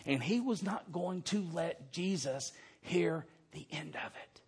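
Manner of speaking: drops to near silence at the end; softening to a whisper of awe; fading hush. Acted. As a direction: let it fade into a hush. Preacher lowers voice for effect.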